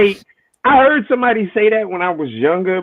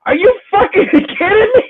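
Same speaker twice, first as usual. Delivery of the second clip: much higher, louder, cracking with laughter; laughing outrage; 6 dB louder than usual. Real, not acted. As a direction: laugh through your outrage.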